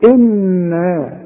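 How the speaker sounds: إن held long with nasal hum; tajweed ghunna on إن. Shown as a demonstration. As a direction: stretch the nun in إن through the nose. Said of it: "لازم تغن".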